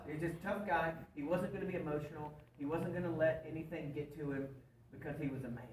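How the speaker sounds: ordinary talk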